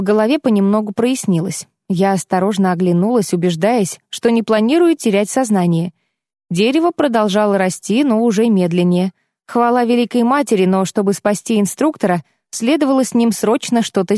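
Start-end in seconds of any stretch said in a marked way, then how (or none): none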